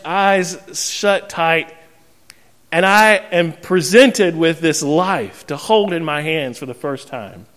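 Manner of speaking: ordinary talk, nothing marked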